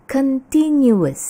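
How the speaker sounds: CONTINUOUS slow and loud, con-TIN-yu-us; correct continuous. Shown as a demonstration. Teacher answers how to say it, careful pronunciation. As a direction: stretch out every syllable of CONTINUOUS. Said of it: "Bagaimana mengucapkannya?".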